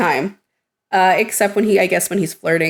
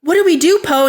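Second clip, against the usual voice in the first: higher, louder desperate question; panicked plea; 8 semitones higher, 3 dB louder than usual. Retold or imitated. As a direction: beg the leader for orders. Pilots voiced in panic.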